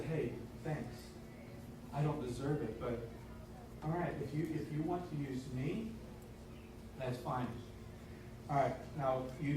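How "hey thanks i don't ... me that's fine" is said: a soft, humble thank you; hushed and humbled, accepting the call; humble quiet reply. Acted. Humble reply to God, acted.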